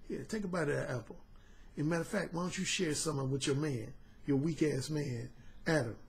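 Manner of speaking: low hushed purr; soft satisfied murmur; quiet, low and pleased; smooth serpent. Retold or imitated. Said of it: "that serpent was smooth".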